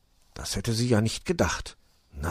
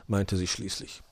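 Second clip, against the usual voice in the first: ordinary delivery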